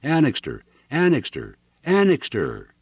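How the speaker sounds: high ringing call of a name; bell-toned shout. A narrator's reading. Fugitive galloping up, shouting for help, bell-toned shout. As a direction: call out urgently from horseback. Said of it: "a great bell-toned voice shouted".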